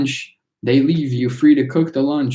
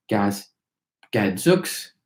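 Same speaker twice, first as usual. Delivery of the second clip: ordinary delivery, nothing marked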